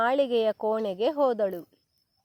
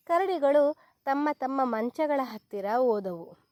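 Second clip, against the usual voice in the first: plain reading voice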